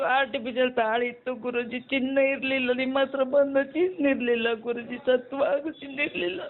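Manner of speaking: tearful voice; real tears welling up; crying. Real, not acted. Devotee tearfully telling the guru she had no gold, crying. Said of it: "ಅಳಬೇಡ ನನ್ ತಾಯಿ".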